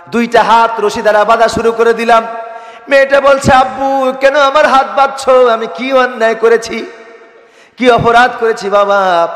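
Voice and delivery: put-on high, loud sermon wail of a child's questions; impassioned preacher's pleading. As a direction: plead for the little girl in a ringing preacher's voice. (ওয়াজের সুরে উঁচু গলায় আকুতি)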